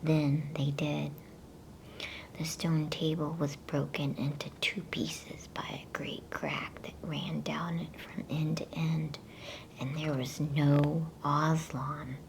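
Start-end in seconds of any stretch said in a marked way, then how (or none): none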